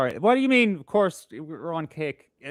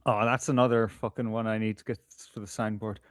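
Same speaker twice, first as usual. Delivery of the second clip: usual speaking voice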